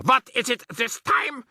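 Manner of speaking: witch shouting. A narrator's reading. Grand High Witch shouting back at grandmother. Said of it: "shouted the Grand High Witch".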